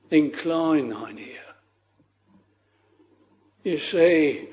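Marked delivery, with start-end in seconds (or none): 0.1-1.6 "Incline thine ear" said much softer, rising at the end; hushed echo of the prayer; softer repeated plea. Retold or imitated. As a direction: let the repeated prayer sink to a hushed appeal. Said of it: "this sinner that is praying like this".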